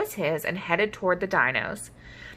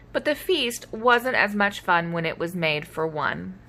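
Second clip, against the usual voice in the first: ordinary delivery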